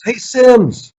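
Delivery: mock summons from the demanding coach; gruff coach calling. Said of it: "I can hear the tone of this".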